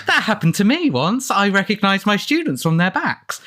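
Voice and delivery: earnestly sharing a tiny story; mock letter writer. Reading the Australian fan's letter to Christie, mockingly eager. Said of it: "somebody wrote to Agatha Christie to say".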